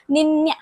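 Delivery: निन्या said "ni-nya" with a blended ny; niña pronounced. Shown as a demonstration. Teacher models the Spanish word niña, blending n and y. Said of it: "ऐसे बोला जाता है".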